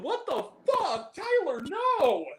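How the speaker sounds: very high, slow wail of disbelief; out-loud outburst. Retold or imitated. Viewer protesting to the filmmaker after nearly spitting, out loud. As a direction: stretch it into a high-pitched protest. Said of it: "Like, out loud".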